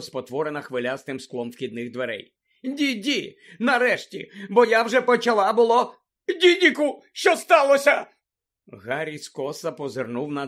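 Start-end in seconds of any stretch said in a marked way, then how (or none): 2.6-8.1 high voice building to a loud cry; fussing, then alarmed. A narrator's reading. Aunt greeting her son at the door, fussing then alarmed. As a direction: gush with relief, then panic.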